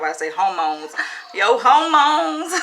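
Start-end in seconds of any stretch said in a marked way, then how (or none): 0.3-0.9 hormones said the Southern way, hoh-mones with no r; a light Southern drawl on hormones; Southern accent. Shown as a demonstration. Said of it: "My, my southern accent is coming out". 1.3-2.5 hormones stretched slow, loud and high in a hammed-up Southern twang; laughing Southern caricature of hormones; exaggerated Southern accent. Shown as a demonstration. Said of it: "My, my southern accent is coming out".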